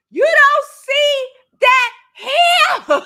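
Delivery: half-laughing, half-yelling in disbelief; exasperated laugh. Retold or imitated. Scolding about mess left around.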